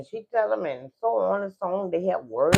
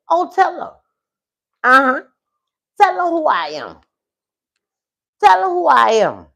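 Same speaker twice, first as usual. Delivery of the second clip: loud, raised-pitch confrontational demand; insistent demand; 7 semitones higher, 9 dB louder, faster than usual. Acted. Wife at the door confronts the other woman, insistent shout. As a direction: push each phrase out louder, pressing for an answer.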